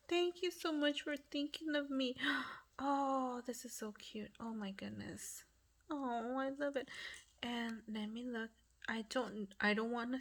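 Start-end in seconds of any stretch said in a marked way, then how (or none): 3.5-5.4 softly whispered so cute. Real, not acted. Crafter admiring the card, whispering. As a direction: breathe it in awe.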